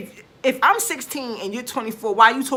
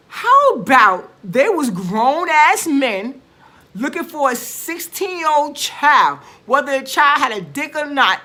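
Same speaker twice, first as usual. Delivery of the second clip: slow, louder, dragged-out indignation; real outrage, slowed right down and pushed louder; indignant outrage; 4 semitones higher, 6 dB louder, slower than usual. Real, not acted. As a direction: hammer each word out in disgust. Outraged rant about the killers.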